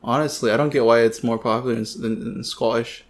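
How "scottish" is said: put-on quiet, half-hearted Scottish accent; bad Scottish accent. Friend tries the word on his co-host, bad Scottish accent. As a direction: try a shaky amateur Scottish accent, softly. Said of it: "my shitty Scottish accent".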